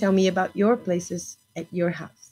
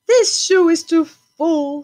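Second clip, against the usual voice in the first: higher, louder, slowed complaint; lightly voiced old woman fretting; old woman complaining; 8 semitones higher, 7 dB louder, slower than usual. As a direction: make her fuss about the cramped shoe.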